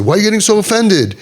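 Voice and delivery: high-pitched mock indignant question; indignant parent. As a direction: imitate a parent brushing off the hurt. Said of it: "when the parents say".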